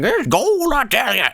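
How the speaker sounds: high, hammy prospector voice; old prospector. Retold or imitated. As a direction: ham up a gold-rush old-timer. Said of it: "an old prospector saying".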